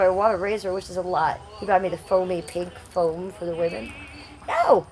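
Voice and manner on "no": a sudden very high squealed "No!"; shrieked no. Real, not acted. Reacting to a viewer's odd question, a shrieked high no. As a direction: shoot way up in pitch, a shocked yelp of refusal.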